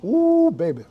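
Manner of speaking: delighted exclamation; excited. Real, not acted. Savoring drink by fire.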